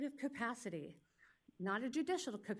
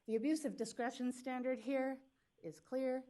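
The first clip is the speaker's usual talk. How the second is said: ordinary delivery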